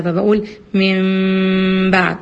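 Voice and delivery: من بعد very slow with a long nasal hum on the meem; audible ghunna. Shown as a demonstration. Teacher making students hear the ghunna in من بعد. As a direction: let the nasal ring out in من بعد. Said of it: "سامعين الغنة وأنا بنطق فيها".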